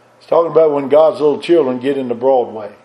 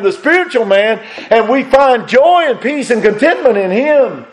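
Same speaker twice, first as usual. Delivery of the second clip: full-force preaching; 7 semitones higher than usual. Acted. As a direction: build to the climax, then hold it. Preaching against the old man.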